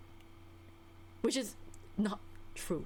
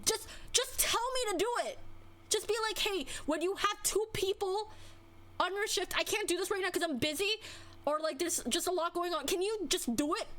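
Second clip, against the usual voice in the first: exasperated; 8 semitones higher than usual. Real, not acted. Venting, raised exasperated pitch.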